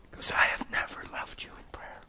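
whispered confession; barely voiced, solemn whisper; hushed repetition. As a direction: whisper it, letting it sink in. Confession repeated after long pause.

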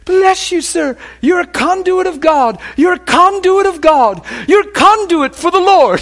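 high, loud mock yell of an overcome man; imitated yelling. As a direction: holler blessings far above your own pitch. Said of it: "He's yelling".

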